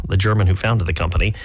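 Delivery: usual speaking voice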